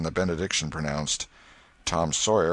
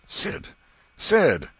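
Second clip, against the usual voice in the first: louder, higher exasperated call; aggravated; 11 semitones higher, 9 dB louder than usual. A narrator's reading. Tom calling his sleeping brother Sid, aggravated. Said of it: "Tom was aggravated".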